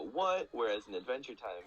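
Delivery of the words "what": high, louder startled what; baffled what. Retold or imitated. Describing how the show should make viewers react, a startled what. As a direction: blurt it out in disbelief. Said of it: "make you go".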